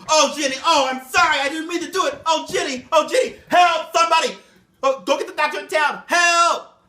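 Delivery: loud, high desperate cries; screaming. Acted. Master screaming for help over wounded Jenny. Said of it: "Master Harrison screams".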